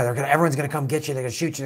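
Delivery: normal talking voice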